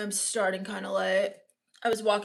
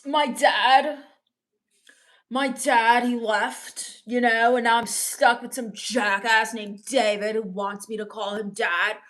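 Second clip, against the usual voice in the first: usual speaking voice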